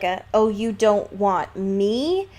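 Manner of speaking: ordinary talk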